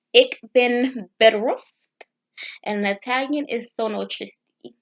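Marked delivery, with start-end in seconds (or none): 0.1-1.7 ik ben bedroefd slow and louder; careful Dutch phrase. Shown as a demonstration. Teaching viewers Dutch, slow and firm. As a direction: sound out ik ben bedroefd deliberately. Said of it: "in Dutch is".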